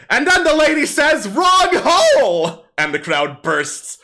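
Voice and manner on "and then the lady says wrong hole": loud, high-pitched stage punchline; kobold comedian. Acted. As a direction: belt out the punchline like a crude kobold comic. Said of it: "you hear him say".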